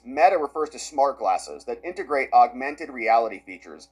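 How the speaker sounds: usual speaking voice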